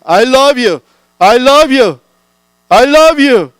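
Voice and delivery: high, loud shout of love; child shouting love from the hilltop. Acted. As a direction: call it out across the hill. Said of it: "애가 소리칩니다".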